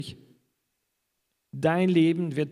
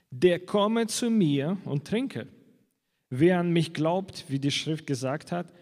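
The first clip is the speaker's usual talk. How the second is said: solemn scripture reading; 4 semitones higher than usual. Acted. Reads Jesus' words from John.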